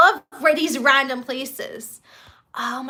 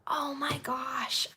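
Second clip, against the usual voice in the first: whispering; 12 dB quieter, much slower than usual. Real, not acted. Awed reaction to reveal.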